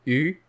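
U said as a rounded French u; isolated French vowel U; French U sound. Shown as a demonstration. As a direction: round the lips for U. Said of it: "Here we have the sounds".

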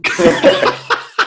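high giggling laugh; burst of laughter. Real, not acted. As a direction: laugh out loud, caught off guard. Laughing at teasing about Tex.

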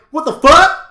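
high, loud disbelieving cry; shocked outburst. Retold or imitated. Recalling the credits rolling, high loud disbelief. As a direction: throw it out in stunned disbelief. Retelling reaction to cliffhanger.

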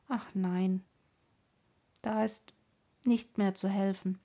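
subdued, resigned sorrow; halting, mildly mournful reply; sad. A narrator's reading. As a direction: answer sadly, with pauses between phrases. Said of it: "sagte die Schwester traurig".

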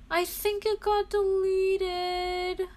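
dejected whimper. Real, not acted. Dejected at the lost post.

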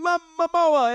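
a comic Italian accent yell of "Momoa"; mock Italian accent. Shown as a demonstration. Podcast host mocking a movie ad in a hammed-up Italian accent. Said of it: "in a ridiculously racist Italian accent".

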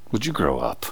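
low, hushed, let-down rebuke; quiet disappointed reproach. Retold or imitated. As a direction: say it softly and low, like a weary let-down. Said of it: "he says it just like that".

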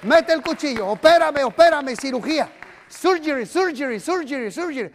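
rising fervent plea; fervent prayer. Acted. Pastor leading the church in prayer, fervent plea. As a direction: cry out for healing. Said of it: "Diga conmigo".

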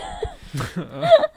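shrieking laugh, high and breathless; high squealing laugh. Real, not acted. Laughter after blood story.

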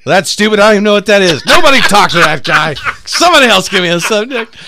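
high, loud dismissive rant; angry yelling comic. Retold or imitated. Comic snapping at the audience member, loud and high. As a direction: bark it at the crowd, fed up. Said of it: "He goes".

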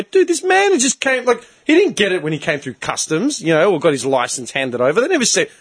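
high-pitched, exasperated arguing; indignant protest. Retold or imitated. Arguing with the council for his neighbour, indignant. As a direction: plead the case, pitch leaping up in outrage. Acted argument with council over sign.

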